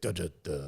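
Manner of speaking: low, fading engine sputter; sputtering engine noise. Retold or imitated. Imitating the engine quitting for good, low sputter. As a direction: mimic the engine dying out. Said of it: "then it's like".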